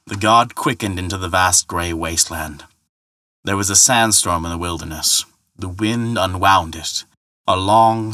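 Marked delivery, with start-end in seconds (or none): none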